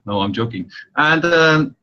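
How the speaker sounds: ordinary talk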